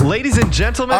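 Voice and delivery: big showman announcement; announcer voice. Pretend. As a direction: announce it like a ringmaster. Grand announcement of silly topic.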